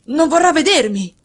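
cry out. A narrator's reading. Ferguson fears his wife will refuse him, loud cry. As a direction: cry it out in dismay. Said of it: "gridò Ferguson".

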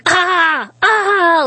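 high-pitched imitated screams; mock kids' screaming. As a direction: imitate the kids shrieking in the water. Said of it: "like all the screaming".

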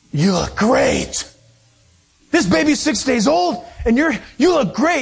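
imitated astonished compliment, swooping pitch; amazed gushing. Stewardess to supposed new mother, mock amazed gushing.

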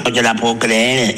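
ordinary talk, nothing marked

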